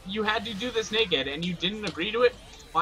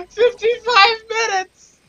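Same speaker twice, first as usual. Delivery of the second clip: very high, drawn-out wail; hysterical disbelief; 13 semitones higher, 6 dB louder, slower than usual. Real, not acted. Reacting to the run's final time, hysterical wail. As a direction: wail it high and slow, half laughing.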